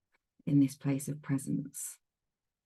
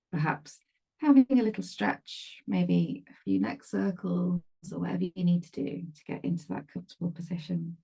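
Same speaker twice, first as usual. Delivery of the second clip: calm meditation guide with long pauses; spacious guided-meditation voice, leaving silence between phrases; 3 dB louder than usual. Real, not acted. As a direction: ease them into stillness, phrase by phrase. Leads a meditation.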